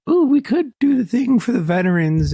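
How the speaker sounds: high, loud gushing clerk voice; mock excited saleslady; imitating the Walmart lady. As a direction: squeal it like an eager cashier. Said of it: "the lady was like".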